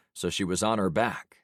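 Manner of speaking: ordinary delivery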